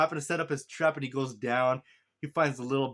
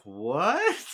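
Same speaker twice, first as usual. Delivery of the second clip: rising, drawn-out disbelieving "what"; incredulous reaction; 4 dB louder than usual. Retold or imitated. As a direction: ask it baffled, about to laugh. Retold disbelief at laser scene.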